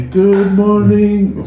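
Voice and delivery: drawn-out chanted schoolroom answer; children's chorus.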